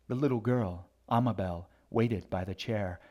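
ordinary delivery, nothing marked

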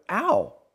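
high, loud "ow"; sudden yelp of pain. A narrator's reading. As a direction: yelp as if squeezed mid-sentence. Child hurt, cut off mid-sentence.

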